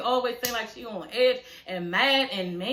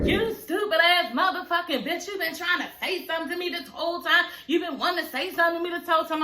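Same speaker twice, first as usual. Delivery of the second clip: higher, fast mock tirade; imitated furious rant; 6 semitones higher, faster than usual. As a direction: rattle it off like she's losing it. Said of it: "Jackie just start kirking out of nowhere".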